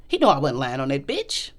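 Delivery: ordinary talk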